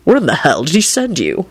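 curious, interested question; mildly intrigued. A narrator's reading. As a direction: ask as if impressed. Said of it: "he asked him with interest".

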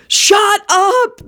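high, exasperated moan. A narrator's reading. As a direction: groan it like a fed-up table. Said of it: "the entire table moaned in unison".

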